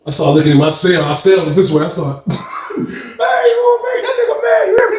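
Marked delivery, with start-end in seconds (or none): 2.3-5.0 very high, squeaky laughing voice; busting out laughing. Retold or imitated. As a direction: re-enact talking through a fit of laughter. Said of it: "Bald head bust out laughing".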